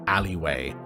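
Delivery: ordinary talk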